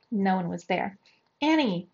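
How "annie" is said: high, falling call. A narrator's reading. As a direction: call for someone out of sight. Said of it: "Jack shouted".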